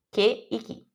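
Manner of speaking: "Que e ki" with a hard k, Italian style; "ki" with a clean k, never sh; hard k like Portuguese que. Shown as a demonstration. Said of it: "em italiano é que".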